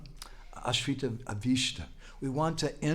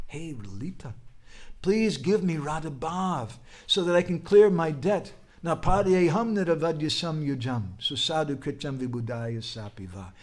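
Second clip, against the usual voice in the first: acted plea, rising higher and louder; pleading prayer; 5 semitones higher, 5 dB louder than usual. Krishna begging Lalita for help, pleading. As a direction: beg a favour as a storyteller voicing a prayer. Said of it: "Krishna began to pray to Lalita".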